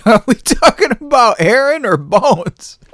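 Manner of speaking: high, slow disbelieving ask; incredulous question. Retold or imitated. Asking the school which kid, slow and incredulous. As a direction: stretch it out in disbelief.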